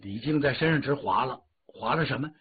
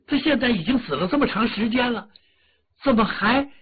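rising indignation; 8 semitones higher, 3 dB louder than usual. Acted. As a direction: build from grumbling to an exasperated cry. Father fuming over son's temple.

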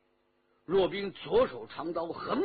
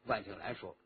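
ordinary delivery

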